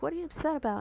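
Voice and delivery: high, mock-innocent question to dad; playing ignorant. To angry dad, faking innocence, high voice. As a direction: ask it sweetly, pretending not to know. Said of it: "I played ignorant".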